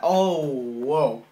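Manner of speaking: loud sudden "whoa"; startled outburst; surprised. Real, not acted. Caught off guard while thanking viewers, loudly surprised. Startled reaction mid-sentence.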